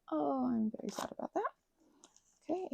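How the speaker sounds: ordinary delivery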